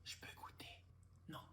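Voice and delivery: hushed voiceless whispering. Acted. Siblings over food at night, whispering. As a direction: whisper it so nobody wakes. Night-time kitchen exchange.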